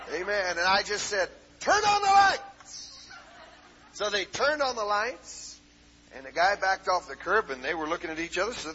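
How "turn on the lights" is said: loud, high full-voiced shout; yelled command. Preacher retelling his order to congregation, yelled command. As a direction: bellow it high over the whole room.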